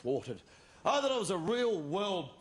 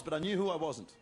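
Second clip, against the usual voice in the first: ordinary talk, nothing marked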